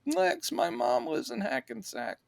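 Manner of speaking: high, soft put-on voice; quoting Otis. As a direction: squeak it out meekly. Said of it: "beat like he did".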